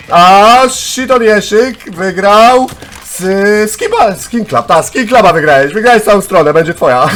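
loud, high, drawn-out winner reveal; excited show host; hyped prize announcement. Real, not acted. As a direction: stretch the name out, then burst out with the prize.

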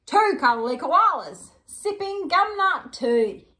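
slow, lilting storybook read; reading to children. Acted. Hosts reading to kids at home, storybook voice.

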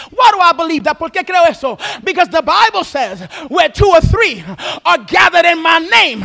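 loud preaching, building in fervour; rising preaching. Acted. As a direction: preach it up to a shout.

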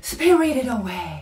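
"spirited away" with a strong puff of breath; breathy. Shown as a demonstration. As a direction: push lots of air through "spirited away", slowly. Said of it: "吐息を出して".

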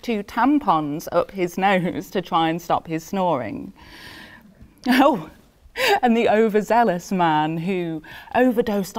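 lively telling with laughter creeping in; amused, half laughing. Acted. Death recalling the doting husband and overzealous man, amused and chuckling. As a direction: relish the grim joke, chuckling before the last remark. Keeps joking about absurd deaths.

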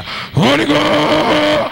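long, slow, high, loud call; Holy Ghost cry. Real, not acted. Pastor cueing the crowd to answer fire, drawn-out high shout. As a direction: draw it out to raise the response. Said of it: "as I say Holy Ghost, I want to hear fire".

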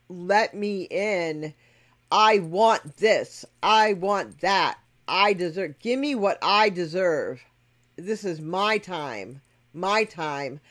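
loud, slow, insistent demands of a spoilt entitled soul; mock demanding. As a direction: hammer each demand out slowly and loudly, like a spoilt child. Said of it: "sit there and demand".

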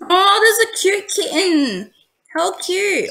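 high-pitched gushing over a kitten; cooing. Real, not acted. Player spotting a cute kitten in game, cooing. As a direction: coo slowly in a high, adoring voice.

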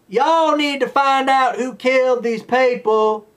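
hammed-up Southern cop accent; mock Southern accent. Mocking the cop's Southern accent, exaggerated drawl. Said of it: "her accent came and went".

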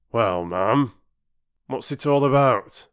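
a hint of a nosy station master; lightly voiced railwayman asking questions; curious station master.

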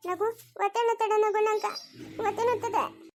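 high, plaintive cry for help; labour pain. Acted. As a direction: whimper through a belly cramp. Labour pain, others soothe her.

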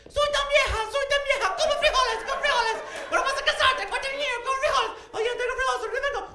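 put-on very high, loud old granny voice in Spanish; grandmother's voice in Spanish. Grandson repeats grandmother's words in Spanish, very high and loud. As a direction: squeal like a tiny abuela pushing frijoles. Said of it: "puedo oír su voz diciendo".

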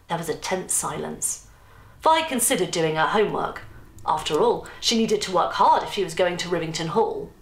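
ordinary delivery

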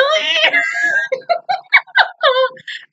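high shrieking laugh, half a cry; shrieking laugh that tips into a shout. Real, not acted. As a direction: burst into a squealing laugh. Bursts out laughing at the screen.

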